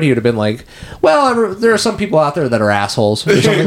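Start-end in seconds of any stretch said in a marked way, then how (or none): 1.0-3.3 higher-pitched put-on jab; a hint of Fletcher, pitched up; lightly voiced Fletcher mode. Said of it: "like, the Fletcher mode".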